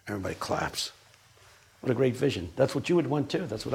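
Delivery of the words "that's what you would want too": normal talking voice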